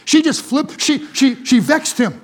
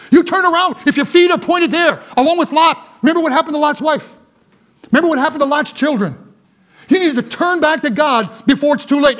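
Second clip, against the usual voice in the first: fervent preaching; 5 semitones higher than usual. Real, not acted. Preaching at full force.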